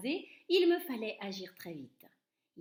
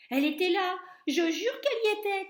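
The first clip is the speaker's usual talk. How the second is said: high, loud insistent protest; indignant shout; 10 semitones higher, 10 dB louder than usual. A narrator's reading. Cook insisting to laughing colleagues, high loud protest. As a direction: swear it loudly, outraged. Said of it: "criait l'homme".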